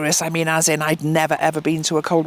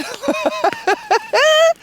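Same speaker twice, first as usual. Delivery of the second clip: high laugh. Real, not acted. Laughing at her own story.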